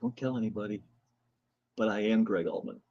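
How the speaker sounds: low, hushed mock confession; retold secret, soft and low; confiding. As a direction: drop your voice as if sharing a secret. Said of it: "I lean over and I said".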